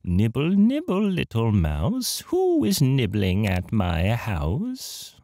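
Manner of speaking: high, slow squeaky crone; lured-in hag calling from inside her house; squeaky witch voice. Acted. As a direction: squeak it out like a sly old witch. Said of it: "a squeaky voice from somewhere says".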